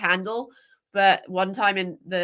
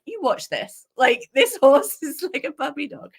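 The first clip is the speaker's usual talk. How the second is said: laughing boast; 7 semitones higher, 3 dB louder than usual. Retold or imitated. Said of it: "I'm like".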